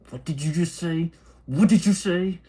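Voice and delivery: stern angry dad. Retold or imitated. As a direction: demand an answer from your son. Father confronting son after bad word.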